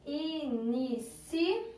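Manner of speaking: "Inici-" drawn out slowly in separate syllables. Shown as a demonstration. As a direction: stretch "Inici-" into soft, slow beats. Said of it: "separar essas duas palavrinhas em sílabas".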